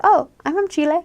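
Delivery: ordinary delivery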